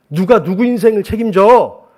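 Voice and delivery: loud, high, drawn-out rebuke; scolding wife. Retold or imitated. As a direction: imitate an exasperated wife telling off her husband. Wife's retold scolding rebuke.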